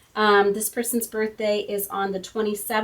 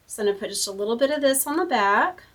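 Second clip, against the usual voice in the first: ordinary talk, nothing marked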